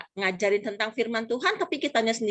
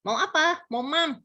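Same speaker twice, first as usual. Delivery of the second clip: put-on higher, childlike baby talk; toddler lisp; 8 semitones higher, slower than usual. Parent talking with a toddler about food, cadel baby talk. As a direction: imitate a lisping toddler's speech. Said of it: "ngomongnya suka cadel".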